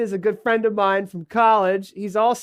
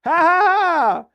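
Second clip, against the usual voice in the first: fake laugh; 8 semitones higher, 4 dB louder, slower than usual. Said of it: "We're supposed to play laughing sounds after that".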